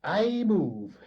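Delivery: high, loud formal motion; pompous Doogie. Acted. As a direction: announce it grandly.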